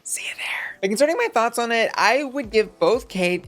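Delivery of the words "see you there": hushed, whispered invitation; soft whispered send-off; whispered sign-off. Real, not acted. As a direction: whisper it like a private aside. Whispered sign-off after Patreon plug.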